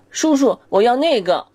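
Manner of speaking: put-on very high kid's plea; child begging. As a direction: ask sweetly like a little child.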